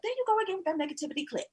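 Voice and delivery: quick, high, dismissive; breezy brush-off. Retold or imitated. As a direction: rattle it off fast, waving him away.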